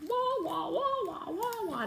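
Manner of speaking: high playful wah wah; sing-song fake baby crying; mock crying wah. Teacher voicing the holes in the page, high mock wailing.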